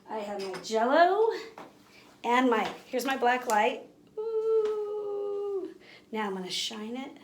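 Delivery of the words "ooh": high, drawn out wonder; impressed ooh. Real, not acted. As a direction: coo at the glowing Jell-O. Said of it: "reacting to glow".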